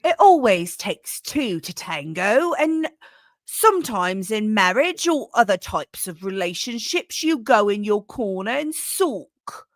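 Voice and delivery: put-on Alexander Stubb in a British accent. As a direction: read the Finnish president's quote in a put-on British accent. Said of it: "so I made, I made him British".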